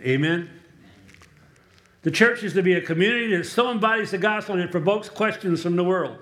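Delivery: ordinary talk, nothing marked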